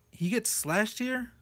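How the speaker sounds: ordinary talk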